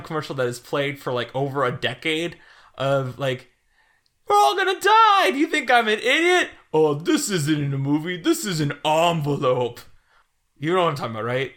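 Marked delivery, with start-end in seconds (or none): 4.3-5.3 high, loud panicked yell; panicked screaming. Retold or imitated. Quoting M&M commercial panic. 5.3-9.8 commercial character voice. Retold or imitated. Quoting M&M commercial.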